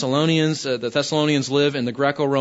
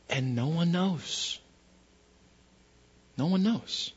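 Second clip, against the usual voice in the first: hushed; 8 dB quieter than usual. Real, not acted. Pastor warning of a hidden cancer, hushed. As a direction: let it fall to a grave hush. Hidden danger, dropped voice for effect.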